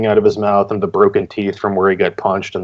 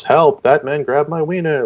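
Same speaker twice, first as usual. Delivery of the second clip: mock yell for help; 7 semitones higher than usual. Reviewer voices a comic-panel dude calling to readers, mock yelling. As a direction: act out a half-hearted yell for help. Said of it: "like, you know, yelling at us".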